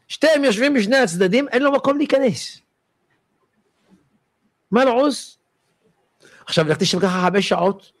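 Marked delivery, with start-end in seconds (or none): none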